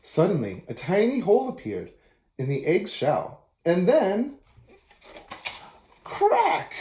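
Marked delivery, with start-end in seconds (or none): 6.0-6.7 crack sound. Acted. Egg shell breaks open.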